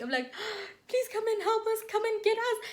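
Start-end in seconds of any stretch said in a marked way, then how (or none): 0.4-0.8 sobbing gasp. Retold or imitated. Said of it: "that time I'm crying". 0.9-2.6 tearful plea. Retold or imitated. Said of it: "that time I'm crying".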